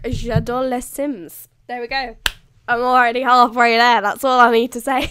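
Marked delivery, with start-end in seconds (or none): 0.0-1.5 slow, careful French "j'adore les Sims"; French phrase. Shown as a demonstration. Showing off her French to viewers, slow proud French.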